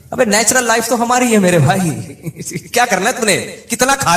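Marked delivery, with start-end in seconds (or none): none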